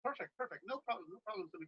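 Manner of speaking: very faint, far from the mic; speaking too far from the microphone; barely audible. Real, not acted. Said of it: "I can barely hear you".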